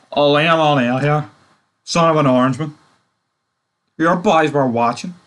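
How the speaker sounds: mock the bad Irish accent from the show. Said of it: "the Irish accents".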